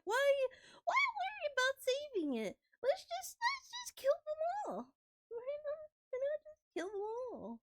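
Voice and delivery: high, airy villain voice. Retold or imitated. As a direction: sell the gleeful destroyer. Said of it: "I was like".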